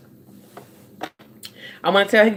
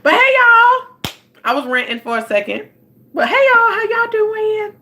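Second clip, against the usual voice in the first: sing-song greeting; 13 semitones higher than usual. Real, not acted. Host calls out hello to viewers joining the live. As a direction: sing out a welcome.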